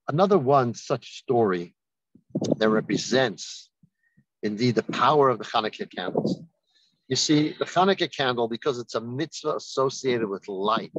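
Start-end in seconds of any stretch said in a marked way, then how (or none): none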